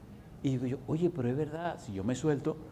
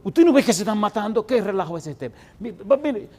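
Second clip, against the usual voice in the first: higher, louder rebuke; shouting at fighters; 8 semitones higher, 8 dB louder than usual. Retold or imitated. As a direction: snap the brawlers out of it. Scolding men fighting in dream.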